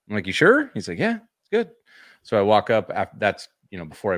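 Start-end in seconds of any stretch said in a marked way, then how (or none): 0.3-0.7 high, loud doubtful check; incredulous high squeak. Retold or imitated. Doubtful question to clerk. 1.0-1.7 high, easygoing salesman; breezy clerk voice. Retold or imitated.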